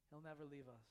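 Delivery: ordinary talk, nothing marked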